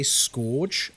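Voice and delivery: "scourge" said wrongly as SKORJ; mispronunciation. Retold or imitated. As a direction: say "scourge" to rhyme with gorge. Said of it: "I hear someone say".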